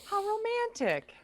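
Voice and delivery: sarcastic. Real, not acted. Sarcastic comment on divorce date.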